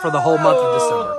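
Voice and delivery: high, loud announcement; excited shout. Real, not acted. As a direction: shout the big reveal. Announcing a huge giveaway.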